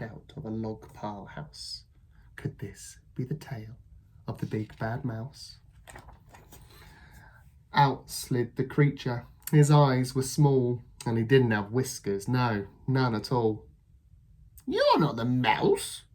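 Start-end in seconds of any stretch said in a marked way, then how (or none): 2.4-5.6 soft, whispered wondering; suspenseful hush. A narrator's reading. Narrator teasing the suspense, whispered. 14.7-16.0 put-on Gruffalo child. Child meets the snake.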